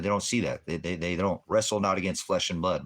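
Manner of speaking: normal talking voice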